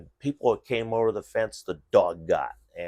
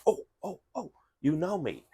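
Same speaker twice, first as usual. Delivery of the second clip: high, eager dog voice, clearly higher; voicing the dog; 10 semitones higher than usual. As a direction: play the dog that suddenly knows you. Dog voiced as recognizing owner.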